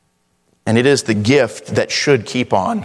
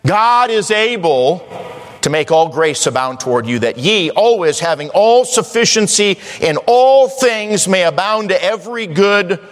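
high, ringing scripture delivery; preaching; 7 semitones higher than usual. Real, not acted. Preacher declaring God is able, high emphatic preaching. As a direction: preach it out, stretching the key words. Preaching verse at full force.

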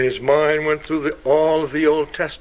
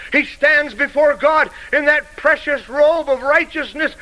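full-force preaching; 8 semitones higher than usual. Acted. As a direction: ring out the victory to the congregation.

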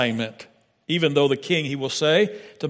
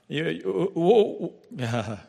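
stammering; 4 dB quieter, much slower than usual. Retold or imitated. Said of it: "some commentators said that he had a speech impediment".

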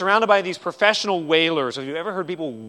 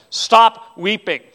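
loud, slow, bold order; audacious command; 5 semitones higher, 11 dB louder, much slower than usual. Retold or imitated. Jesus to a grieving woman, loud audacious command. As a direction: bold command, each word drawn out and louder. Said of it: "He audaciously says".

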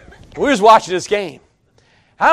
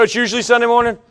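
ordinary delivery

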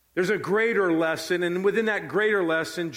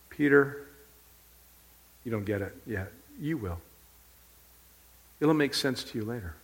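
calm Jesus; 5 semitones lower than usual. Acted. Jesus telling Peter he will understand later, calm. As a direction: lower the voice and reassure gently. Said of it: "Jesus states this simple fact".

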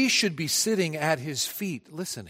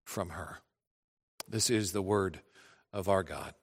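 scripture reading; 5 semitones lower than usual. Acted. Preacher reading Luke to the congregation, solemn and hushed. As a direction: read it like holy writ, hushed.

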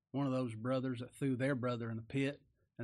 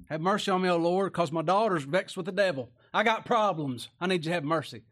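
loud, high begging mother; desperate plea; 4 semitones higher, 8 dB louder than usual. Acted. Mother begs the Lord to heal her daughter, loud pleading. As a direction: cry out to the Lord for your daughter.

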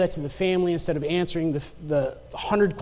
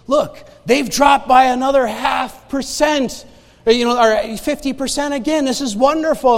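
higher-pitched, encouraging announcement; doctor's bright report, retold; upbeat good news; 5 semitones higher, 4 dB louder than usual. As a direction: deliver great results with enthusiasm. Oncologist delivering good news.

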